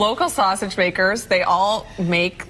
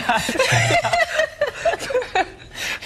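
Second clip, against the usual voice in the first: burst of laughter. Real, not acted. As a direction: crack up laughing. Anchor breaks up after misreading.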